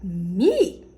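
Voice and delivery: imitating an incredulous denial, swooping sharply up; mock disbelief. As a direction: mimic him acting utterly shocked. Mimics boyfriend's incredulous denial.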